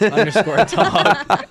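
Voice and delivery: slow spelling, cracking up; breaking into laughter while spelling; laughing. Real, not acted.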